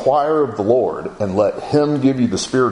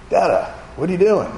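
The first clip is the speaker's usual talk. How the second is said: lightly imitated little boy, higher pitch; higher, a hint of a curious small son; young child; 7 semitones higher than usual. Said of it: "may have been six or seven years old".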